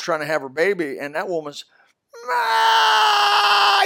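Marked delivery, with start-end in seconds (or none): 2.3-3.8 high, loud mock scream of a woman in labor; imitated scream. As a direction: let out a piercing imitated shriek. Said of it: "screaming like I can't even duplicate".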